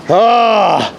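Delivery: high, drawn-out excited "ah"; excited cry. Real, not acted. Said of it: "Sorry, I'm excited again".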